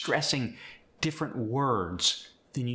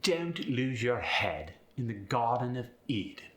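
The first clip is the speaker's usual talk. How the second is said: conceited upper-class British, gliding downward; posh British with a falling pitch glide; British accent, haughty downward glides. Shown as a demonstration. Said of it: "when spoken by someone who is upper class".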